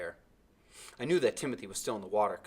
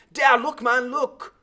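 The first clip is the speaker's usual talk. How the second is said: high, loud scornful pointing; 13 dB louder, slower than usual. A narrator's reading. Timothy scornfully points the blind boy to the island. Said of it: "Timothy answered scornfully".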